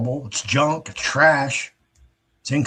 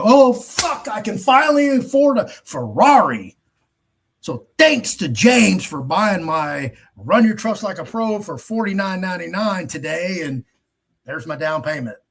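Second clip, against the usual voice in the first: higher and louder, hammed-up glee; mock thrilled seller; 5 semitones higher, 4 dB louder than usual. Mocking himself as a greedy seller, hammed-up excitement. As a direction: fake giddy joy over a sale. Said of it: "run right out tomorrow and go".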